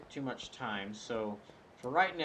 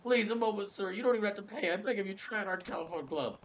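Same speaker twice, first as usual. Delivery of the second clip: higher begging salesman voice; higher pleading clerk voice; 10 semitones higher, 4 dB louder than usual. Acted. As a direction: beg him to try the club. Clerk begs.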